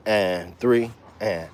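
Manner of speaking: slow, even count on the ands; half-time count with the ands. Shown as a demonstration. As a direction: spell out each beat slowly. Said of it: "slowed it down a half the time".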